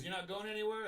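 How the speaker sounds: incredulous cop asking; surprised. Retold or imitated. Cop surprised at his answer.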